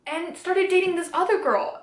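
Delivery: acted-out upset, no tears; retold emotional distress. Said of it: "I was emotionally distressed".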